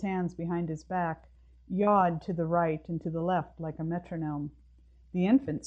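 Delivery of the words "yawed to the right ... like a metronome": ordinary delivery, nothing marked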